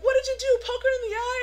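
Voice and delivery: loud, high incredulous question; mock accusation. Star leans over to blame Itty, high mock accusation.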